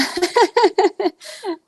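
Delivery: a big burst of laughter at her own joke; loud laugh. Real, not acted. Laughing at her own joke about Montana. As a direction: laugh out loud, high and bright.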